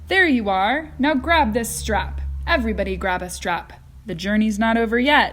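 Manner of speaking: loud, gallant host; courteous but commanding over the din; gallantly. A narrator's reading. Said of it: "said Mister Wonka gallantly".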